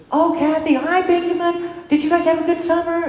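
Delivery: high, sugary teacher greeting; gushing teacher. Retold or imitated. Teacher Renee greeting mother and son, high and gushing. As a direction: gush over them like a cheery teacher.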